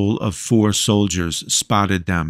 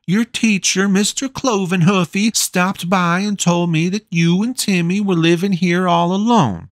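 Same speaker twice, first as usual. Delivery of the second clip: much higher, firm motherly insistence; bossy, doting mother; 10 semitones higher than usual. A narrator's reading. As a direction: brook no argument, a big mom in charge. Voices the mother Gretchen.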